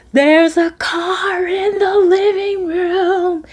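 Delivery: mock scream. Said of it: "he's screaming to the top of his lungs".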